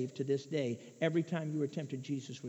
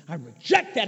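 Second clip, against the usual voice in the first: firm loud rejection; 9 dB louder than usual. Pretend. Firm rejection after repeats.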